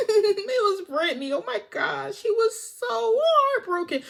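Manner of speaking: high, giggly mocking gossip; Sandy giggling. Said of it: "Sandy starts giggling".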